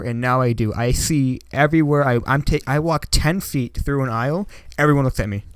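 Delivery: usual speaking voice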